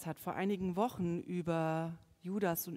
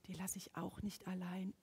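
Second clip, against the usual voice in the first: sudden soft; 3 semitones lower, 10 dB quieter than usual. Acted. Sudden drop after climax.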